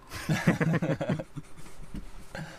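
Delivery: long burst of laughter; real laughter. Real, not acted. Laughs after the punchline pause.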